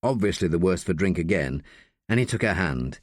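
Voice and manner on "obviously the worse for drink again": ordinary delivery, nothing marked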